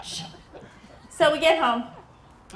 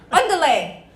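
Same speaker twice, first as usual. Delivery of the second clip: loud, mock-imperious Spanish command; acted bratty order, punched loud; bossy call; 3 semitones higher, 9 dB louder than usual. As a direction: shout it out like hurrying a servant. Daughter orders food in Spanish.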